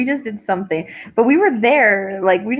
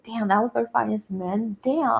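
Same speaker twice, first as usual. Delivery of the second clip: hushed aside, much softer; mock whisper; 8 dB quieter than usual. Player imagines whispering into his ear, mock-whispered. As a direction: lean in and murmur it softly. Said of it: "whisper in his bib and be like".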